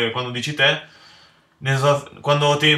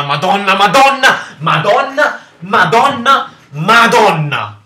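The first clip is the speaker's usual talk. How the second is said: rising, louder, higher exasperated Madonna; building exasperation; 5 semitones higher, 7 dB louder, slower than usual. Real, not acted. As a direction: let it climb into a wail. Repeated cursing building up. (esasperazione crescente)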